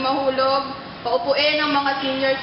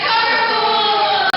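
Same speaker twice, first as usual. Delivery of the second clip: excited; 8 semitones higher, 5 dB louder than usual. Acted. Announcing carpool tip in skit.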